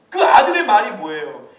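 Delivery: ordinary talk, nothing marked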